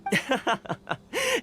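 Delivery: high breathy laugh of amusement; amused laugh. Acted. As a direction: laugh it off lightly. Fixer laughs off Paimon's groan.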